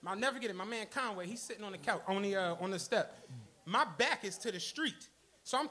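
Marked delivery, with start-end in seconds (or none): none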